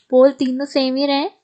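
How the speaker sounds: ordinary talk, nothing marked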